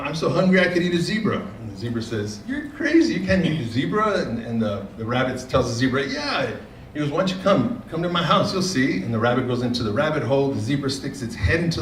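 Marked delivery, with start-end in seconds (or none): 0.0-1.4 rabbit voice. Acted. Rabbit boasting to zebra. 2.5-4.2 zebra scoffs in a much higher voice; scoffing zebra. Acted. Zebra scoffing at the boasting rabbit, high squeaky voice. 6.1-9.0 rabbit voice. Acted. Rabbit inviting zebra home.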